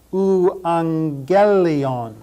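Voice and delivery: Euangelion as oo-ahng-GEL-ee-on, very slow; slow Greek pronunciation. Shown as a demonstration. Teacher showing students the gamma-gamma NG sound, very slow. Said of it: "the two gammas together here have an N G sound".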